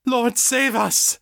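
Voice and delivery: high, slow terrified cry; cried out. A narrator's reading. A frightened man crying to God. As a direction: beg for rescue in terror. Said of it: "one of the men cried".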